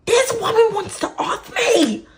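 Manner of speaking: high, loud panicked cry; alarmed shout. Retold or imitated. Retelling her plea to dad, a panicked shout. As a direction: shriek it in disbelief. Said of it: "And I'm like".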